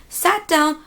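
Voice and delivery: ordinary talk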